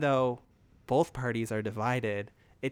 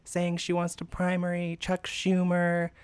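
raised, indignant cut-in; mock outraged interruption; 6 semitones higher than usual. Podcaster imitates Meghan interrupting Joy, mock outrage. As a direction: scoff like you can't believe it. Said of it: "she interrupts Joy and she's like".

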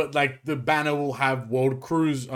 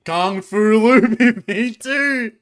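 slow, laughing, higher hype; frat bro; 7 semitones higher, 7 dB louder, much slower than usual. Real, not acted. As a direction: stretch it out, grinning. Hyping imagined unit.

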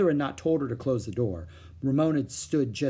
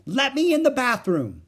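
put-on child's scream; 13 semitones higher, 7 dB louder than usual. A narrator's reading. Little sister screaming to be let in the bathroom. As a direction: scream it through the door like a frantic little girl. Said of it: "screamed Ramona from the hall".

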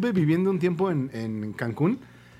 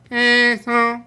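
high, loud, drawn-out vendor call; street vendor shout; 4 semitones higher, 6 dB louder, much slower than usual. Retold or imitated. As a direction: bellow it out like a street seller. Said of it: "un señor que me gritaba".

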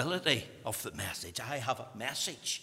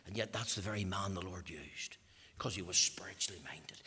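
hushed aside; 6 semitones lower, 8 dB quieter than usual. Real, not acted. Preacher to congregation, an intimate near whisper.

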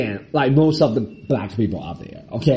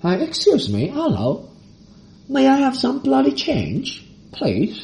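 higher, slow, hammed-up British accent; a beggar trying to sound British with a hammed-up, slow, high British accent; mock British accent; 11 semitones higher, slower than usual. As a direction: beg for change like a posh Brit. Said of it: "pull off British accent".

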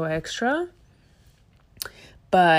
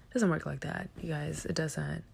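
usual speaking voice

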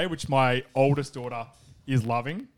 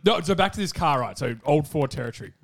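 ordinary talk

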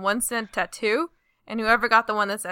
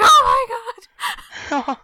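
loud, high mock outcry; mock dismay; 14 semitones higher, 6 dB louder than usual. Friend retells singer learning he must get a tattoo, mock dismay. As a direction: cry out in imitated horror, high and loud.

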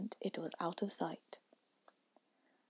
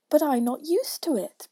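higher, louder plaintive protest; piteous pleading; 7 semitones higher, 9 dB louder than usual. A narrator's reading. Poor Alice pleads with the Caterpillar, piteous. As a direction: plead it with raised pitch. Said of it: "pleaded poor Alice in a piteous tone".

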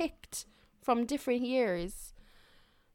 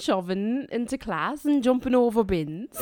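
Northern Irish turning Liverpool. Retold or imitated. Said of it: "Northern Irish by way of Liverpool".

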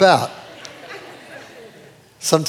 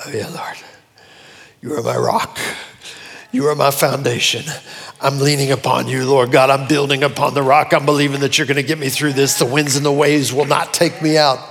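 a soft, lower, intimate prayer voice; mild quiet prayer; 6 semitones lower than usual. Acted. Believer praying to the Lord in trouble, lightly hushed prayer. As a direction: pray it under your breath, trusting. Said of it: "You think".

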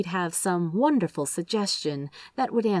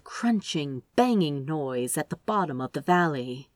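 ordinary delivery, nothing marked

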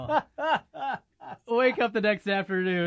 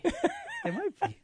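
slow, high, laughing; mock high voice; 4 dB quieter, much slower than usual. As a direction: squeak it out slowly while laughing. Repeats line, second take mocked.

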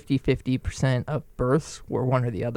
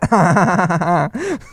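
a shrill fake cackle, higher and louder; put-on high cackle; 3 semitones higher, 5 dB louder than usual. Showing off a terrible laugh to a friend, fake high cackle. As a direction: mimic a silly girl's laugh, pitched high. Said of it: "That was the worst laugh I've ever heard".

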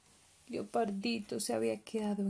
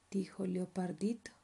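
ordinary delivery

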